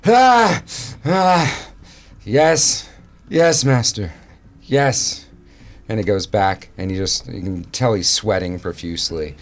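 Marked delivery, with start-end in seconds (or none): 0.1-5.2 shrieking, groveling yes master; hunchback assistant. Acted. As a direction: scream it, cowering servant. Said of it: "The man in the cell screams".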